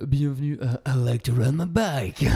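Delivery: slow, smooth radio host welcome; suave voice. Shown as a demonstration. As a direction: purr the show's name like a smooth host. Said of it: "Je vais m'obliger à prendre une voix suave".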